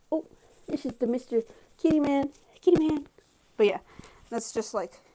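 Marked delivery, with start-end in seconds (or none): 0.1-3.1 pet voice. Real, not acted. Introducing her cat to viewers, high cooing pet talk. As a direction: show off the cat in a sing-song pet voice.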